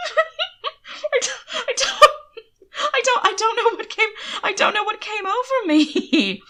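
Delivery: high, breathless words through giggles; laughing. A narrator's reading. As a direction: gasp it out between fits of laughter. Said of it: "he finally gasped".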